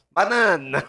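exaggerated Italian pronunciation; Italian accent. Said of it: "banana em italiano".

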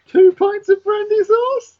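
very high, slow squeak; squeaky high voice. Pretend. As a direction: squeak it out like a cartoon. Player mocks with silly squeak.

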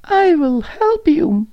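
very high, loud mouse voice; high-pitched cartoon mouse; little mouse. A narrator's reading. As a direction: offer it in a squeaky mouse voice. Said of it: "the mouse said".

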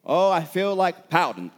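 hammed-up sulky complaint; mock pouting complaint. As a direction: act out a slow, pouty protest. Acts complainer who feels like pouting.

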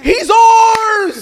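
high, loud triumphant yell; cheering shout. Retold or imitated. Christians cheering over a celebrity convert, loud triumphant yell. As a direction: shout it like a crowd claiming a win. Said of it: "but we were like".

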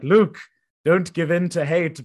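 hammed-up imitation of an awkward ghost; awkward ghost Ben. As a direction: ghost Obi-Wan warning Luke, mock-solemn. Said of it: "he's like".

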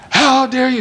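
high-pitched mock yell of outrage; retold yell. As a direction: play the angry CIO, pitch jumping high. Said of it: "starts yelling at me".

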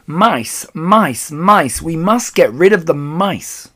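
loud, high, urgent cries; yelling. A narrator's reading. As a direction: yell it like an alarmed crowd. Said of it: "everyone was yelling".

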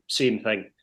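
normal talking voice

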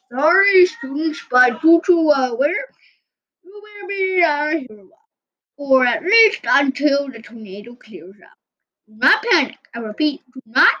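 calm the school over the speaker; loudspeaker announcement. Acted. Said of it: "a voice came over the loudspeaker".

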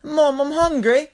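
high-pitched mock Aussie little boy; whiny Australian child. Hungry kid begs his sleepless mom, high Australian child imitation. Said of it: "he's like".

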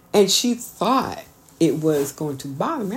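normal talking voice

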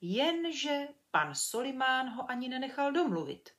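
plain reading voice

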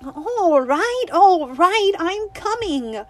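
high, loud exasperated shout, slowing at the end; fed up, yell back toward the house; exasperated cry. Acted. Winnie answering her mother, loud exasperated cry. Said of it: "she cried, exasperated".

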